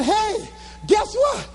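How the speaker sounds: high, drawn-out excited greeting; excited news. Retold or imitated. Speaker phones girlfriend after standing ovation, excited. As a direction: burst with good news, pitched high.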